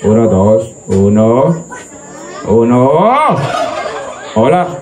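low, slow mic test count; mic check. Real, not acted.

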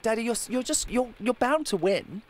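high, childlike imitation of his young daughter; daughter's voice. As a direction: squeak it out like an eight-year-old girl. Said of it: "she's like".

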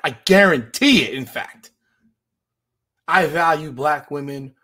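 loud, punched guarantee; emphatic vow. Real, not acted.

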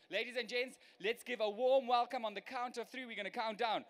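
loud, high crowd-hyping countdown; rousing host call. Real, not acted. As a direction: whip the audience up for the entrance.

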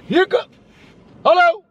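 high-pitched call to come here. Real, not acted. Calling Zextube to come.

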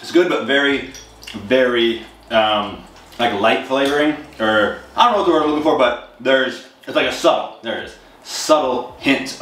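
talking between chews; chewing while talking. Real, not acted. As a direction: mouth partly full of cereal. Seen 4: Bite at -7; jaw and lips keep chewing while he talks.

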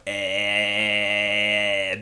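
"Eh" held out long, low and ugly. Shown as a demonstration. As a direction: drag out "Eh" as the worst vowel. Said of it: "especially if you hold it out".

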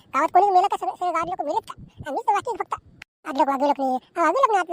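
exasperated shouting husband; angry rant. Acted. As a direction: throw the complaint at her, fed up. Husband ranting during the quarrel.

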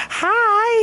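put-on very high, cutesy little-kid hi; a chirpy, babyish greeting; squeaky mock hello. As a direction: pitch way up to echo the child. Mimicking the kid's hello.

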